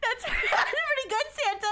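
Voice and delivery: high, loud, laughing praise; amused laughing. Real, not acted. Streamer praising Santa's Alabama accent, laughing out loud. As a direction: say it through a real laugh.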